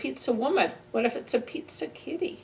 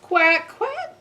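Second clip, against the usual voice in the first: high, louder mock quacking; duck quacks; 7 semitones higher, 6 dB louder than usual. Reading aloud to kids, high loud quacks.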